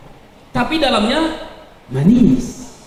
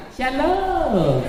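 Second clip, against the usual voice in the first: fake sweet greeting; 4 dB quieter than usual. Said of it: "ada yang munak".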